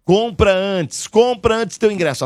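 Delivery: urgent push. Acted. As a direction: push them to buy now. Urging listeners to buy tickets.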